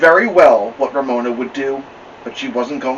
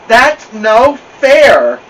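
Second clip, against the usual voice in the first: slow, high, loud taunting whine; mocking sing-song; 11 semitones higher, 12 dB louder, much slower than usual. Big sister mimicking the little sister's whine, slow.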